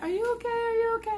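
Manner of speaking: put-on high, concerned girlfriend voice; worried friend. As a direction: imitate her friend asking anxiously. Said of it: "She's like".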